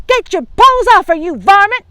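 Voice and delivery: loud, high furious shout; angry granny yell. Acted. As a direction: yell at the wolf in fury. Grandma confronts the wolf.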